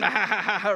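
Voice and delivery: mock belly laugh, ha-ha-ha; imitated uncles' laughing. Uncles watching the boy burn his mouth, imitated laughing. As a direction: imitate a bunch of relatives cracking up. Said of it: "all the theos laughing".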